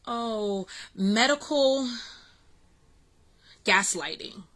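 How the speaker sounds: ordinary talk, nothing marked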